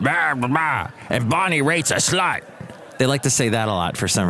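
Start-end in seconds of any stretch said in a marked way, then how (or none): 0.0-2.4 mocking old men.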